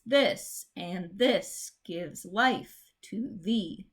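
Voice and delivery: very slow, evenly paced poem reading. Shown as a demonstration. Teacher reading Shakespeare slowly for students to mark, very slow. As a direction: read each syllable slowly so students can mark. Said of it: "I'll read kinda slowly so you can, uh, mark".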